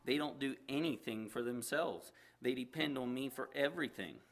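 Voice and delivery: lively read-aloud storytelling voice with acted characters; story narration. A narrator's reading. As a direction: tell the story to the listeners. Reads a story aloud to congregation.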